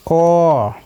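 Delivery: OR held out as one long vowel; long vowel sound. Shown as a demonstration. Teacher comparing short and long sounds, long OR. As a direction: stretch OR out. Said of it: "compare these short and long sound".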